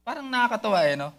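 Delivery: ordinary talk, nothing marked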